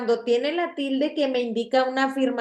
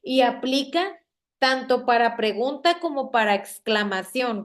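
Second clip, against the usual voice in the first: normal talking voice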